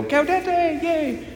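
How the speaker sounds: very high, softened little cheer; tiny falsetto hooray, held on one note; mock celebration. Playful echo of Paul's rejoice.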